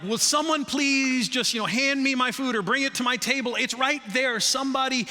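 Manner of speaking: hungry diner begging staff; exasperated hungry customer. Retold or imitated. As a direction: plead for your food, exasperated. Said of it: "you're like".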